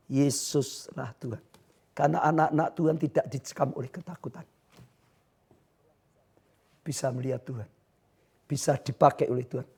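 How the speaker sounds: soft, low, solemn sermon voice; hushed preaching. Real, not acted. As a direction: drop down low and reassure them. (khotbah pelan dan khidmat)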